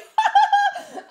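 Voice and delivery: loud high giggle; high laugh. Real, not acted. Seeing the first dress, a loud high giggle. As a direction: burst into a squeaky giggle.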